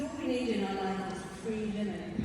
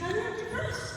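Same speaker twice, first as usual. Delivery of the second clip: imitated high-pitched denial; high protest. Client answers doctor's warning about pills, high protest. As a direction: contradict the doctor in a much higher voice.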